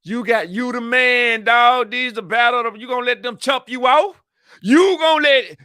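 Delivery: loud, high hyped-up goading; egging on. Acted. Acting out egging on the crew.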